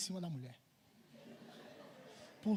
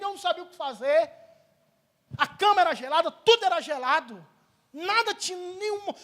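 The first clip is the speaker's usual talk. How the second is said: high, loud, urgent preaching; pregação exaltada; 11 semitones higher, 14 dB louder than usual. Acted. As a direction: tell the freezing ordeal at full volume, pitched way up. Preaches story at full force. (pregação a plenos pulmões, aguda e exaltada)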